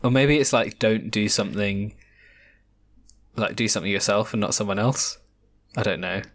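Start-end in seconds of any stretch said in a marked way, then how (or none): none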